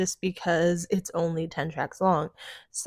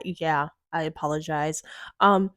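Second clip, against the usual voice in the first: ordinary talk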